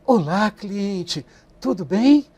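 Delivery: slow, soft, drawn-out fake sweetness; "Olá, cliente" slowly and softly, mock syrupy; wrong tone. Shown as a demonstration. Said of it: "Não é aquele tom de voz".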